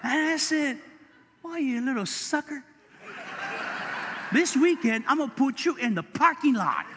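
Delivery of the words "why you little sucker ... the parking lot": higher, louder playful scolding; playful, louder mock threat. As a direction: bark it like a joking boss. Pastor scolds his usher, joking.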